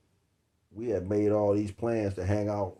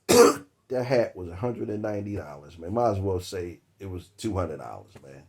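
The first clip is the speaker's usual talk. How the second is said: normal talking voice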